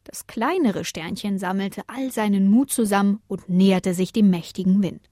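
ordinary delivery, nothing marked

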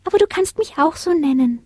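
high, tiny voice; shy little star. A narrator's reading. Smallest star to Lina, shy. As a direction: offer it timidly in a small high voice.

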